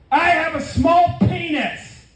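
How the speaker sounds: high, slow, drawn-out mock holler; mock yell. Truck owner rolls down the window to the street, mock yell. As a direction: yell it out like a driver passing by. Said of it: "he yelled out".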